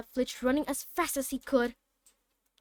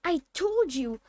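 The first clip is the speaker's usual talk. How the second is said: emphatic; 5 semitones higher, 5 dB louder, slower than usual. A narrator's reading. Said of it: "Hermione gasped".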